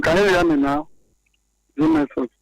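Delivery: ordinary delivery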